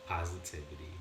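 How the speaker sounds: unhurried phrases, spaced out for breathing; guided meditation. Real, not acted. Teacher leading listeners through breathing in and out, guided meditation voice.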